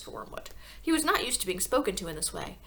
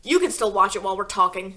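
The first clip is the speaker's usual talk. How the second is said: Mr Wormwood voice; 9 dB louder than usual. A narrator's reading. Husband telling his wife to keep watching, loud and gruff. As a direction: correct it to the gruff father. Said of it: "wrong voice".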